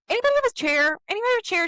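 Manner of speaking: high, loud call to the room; mock-urgent call for a chair. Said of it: "And she was like".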